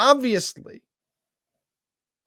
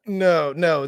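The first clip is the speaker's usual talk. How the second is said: ordinary delivery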